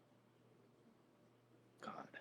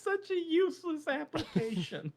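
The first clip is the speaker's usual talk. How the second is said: laughing. Real, not acted. Laughing at own joke.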